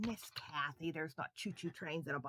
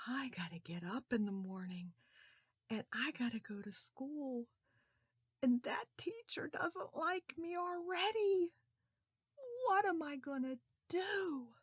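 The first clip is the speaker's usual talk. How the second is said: put-on higher, anxious child; worried boy; 8 semitones higher than usual. Charlie unable to sleep, worried. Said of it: "he thought".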